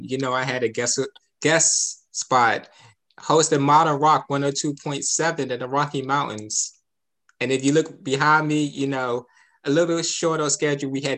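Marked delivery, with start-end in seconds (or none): none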